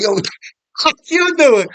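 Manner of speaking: high-pitched, incredulous callout; retold indignant challenge. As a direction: act out calling someone out, voice jumping up. Retold challenge called down to friend.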